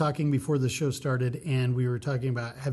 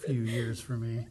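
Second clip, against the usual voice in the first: usual speaking voice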